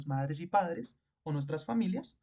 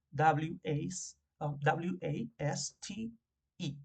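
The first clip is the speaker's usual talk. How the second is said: deletreo lento; much slower than usual. Shown as a demonstration. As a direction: spell out W A S T E slowly, letter by letter. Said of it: "como el verbo waste". (deletreando "waste" letra por letra, muy despacio)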